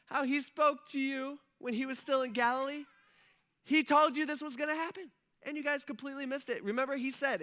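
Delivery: high-pitched, eager reminder; pumped angel. Pretend. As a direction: press the point as an excited angel. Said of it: "this angel's probably pretty pumped".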